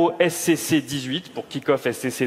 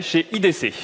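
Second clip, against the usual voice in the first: normal talking voice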